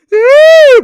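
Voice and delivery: loud high whooping laugh; excited scream of laughter; yelling laugh. Real, not acted. Reacting to play, yelling laugh.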